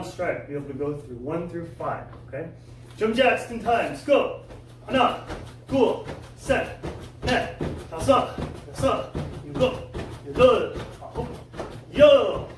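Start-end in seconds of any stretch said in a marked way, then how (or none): 3.0-12.5 loud, high drill count, speeding up; coach counting. Real, not acted. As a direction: bark out the count like a drill instructor. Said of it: "coach counts jumping jacks".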